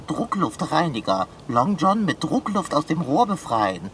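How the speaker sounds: low, subdued soliloquy; grumbling to oneself. Acted. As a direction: mutter disgruntledly, left alone. Left alone, grumbles to himself.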